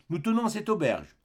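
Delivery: a landlady sizing up a stranger; Thénardier speaking. Acted. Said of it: "dit la mère des deux petites". (la Thénardier, voix plus aiguë)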